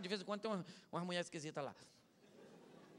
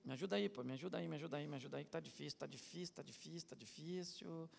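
softer and softer, begging; mock prayer, fading to a murmur; fading plea; 4 semitones lower, 10 dB quieter than usual. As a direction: plead to God, fading away. Praying to God for help.

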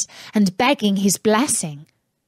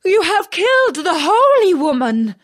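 higher, louder, appalled princess; horrified princess; 9 semitones higher, 5 dB louder than usual. Acted. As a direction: accuse him in shock as the princess. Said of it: "cried the princess".

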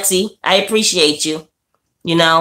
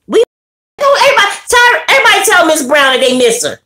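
calling out; 12 semitones higher, 5 dB louder than usual. Real, not acted. Calling out to absent viewer.

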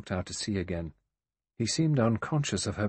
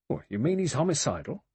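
higher, incredulous rising question; 6 semitones higher than usual. A narrator's reading. Blore questioning the doctor's suspicion of MacArthur, incredulously. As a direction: query it in disbelief. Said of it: "Blore said incredulously".

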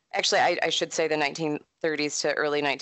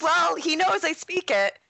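sassy retort; 8 semitones higher than usual. Retold or imitated. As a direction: toss off the comeback with mock sass. Retells her sassy reply.

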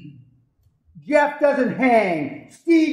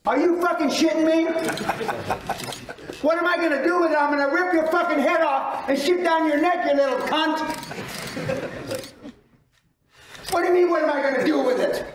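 higher-pitched furious ranting; angry rant; 5 semitones higher, 5 dB quieter than usual. Real, not acted. Answering a question about the cricket bat, angry rant. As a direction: threaten with mock fury.

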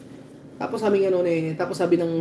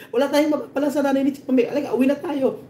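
high-pitched, exasperated official giving up; mock exasperated official; 9 semitones higher than usual. Official tells everyone to go home, high mock exasperation. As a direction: throw up your hands in a high mock whine. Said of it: "Sabi ganun".

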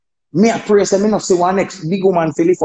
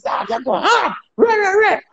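promoter's mock hype, much higher; hyped promoter. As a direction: play the promoter cheering him on. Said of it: "the promoter say".

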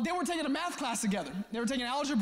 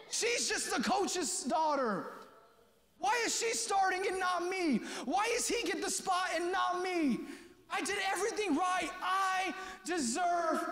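mock whining; 5 semitones higher than usual. Imitating a whining benched athlete.

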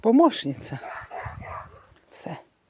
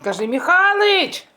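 loud, high call across the yard; calling husband outdoors, loud and high; 10 semitones higher, 5 dB louder than usual. Real, not acted. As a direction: shout his name out to him.

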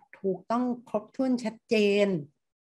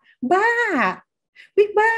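much higher, playful coy squeal; shy teasing; 13 semitones higher, 6 dB louder, much slower than usual. Shown as a demonstration. Embarrassed friend teasing back, coy and much higher. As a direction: say it like a bashful, flirty tease. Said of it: "เขาพูดด้วยความเขินอาย".

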